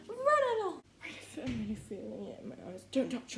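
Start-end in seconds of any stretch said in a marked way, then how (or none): 0.1-0.8 much higher and louder, cooing at a dog; squeaky pet talk. Real, not acted. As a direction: shoo the dog off in a sing-song squeak. Sending the dog off.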